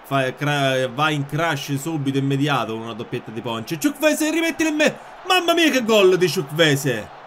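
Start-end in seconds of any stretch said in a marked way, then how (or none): 3.8-7.2 high, loud goal commentary; excited goal call. Real, not acted. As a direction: shout the goal like live TV. (urlo da telecronista)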